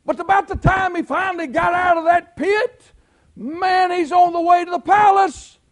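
high, loud shout of hope; preacher crying out as Joseph nears the palace; preaching shout. Acted. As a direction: lift the church with a cry. Preaching climax.

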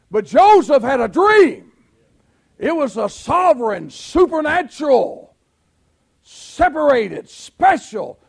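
loud, high, building preacher's cry; Southern preacher whooping up to a roar; full-force preaching. Acted. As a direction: stack the words and climb to a shout.

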